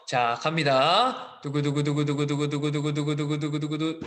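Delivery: vocal drumroll; hyped giveaway announcement. Acted. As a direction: build suspense with a spoken drumroll. Drumroll before picking quiz contestant.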